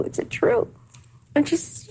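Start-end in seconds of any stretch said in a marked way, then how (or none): 0.0-0.7 breathless whisper, the question fading out; whispered repeat. Retold or imitated. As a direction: let the voice drop to a whisper in shock.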